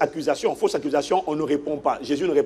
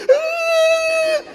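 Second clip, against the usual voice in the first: high wailing cry. Retold or imitated. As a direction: let out a high sobbing cry. Maid crying out.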